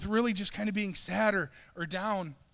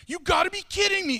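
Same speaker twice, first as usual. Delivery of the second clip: much higher and louder, thrilled; excited disbelief; 11 semitones higher, 7 dB louder than usual. Retold or imitated. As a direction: burst out like the greatest news ever. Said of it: "he would be the ones that was like".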